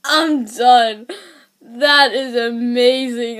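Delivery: loud, laughing-edged exclamation; excited outburst. Real, not acted. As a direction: burst out, done for. Bursting after the funny clip.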